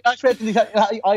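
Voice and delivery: ordinary talk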